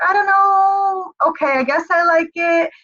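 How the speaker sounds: reluctant mock reply. Mimicking a hesitant teammate who doesn't love the changes. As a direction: drag it out like an unsure newbie.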